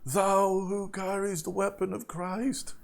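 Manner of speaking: high, strained acolyte's cry at ordinary volume; hammed-up screamed challenge, pitched well up; mock scream. Acolyte challenging Lafrog to combat, mock screaming. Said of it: "and he screams".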